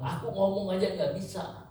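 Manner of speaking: preacher voicing Moses admitting he cannot speak; pleading Moses. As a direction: sound small and unable before God. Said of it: "Dia bilang".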